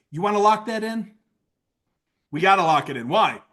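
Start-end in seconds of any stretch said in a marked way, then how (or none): none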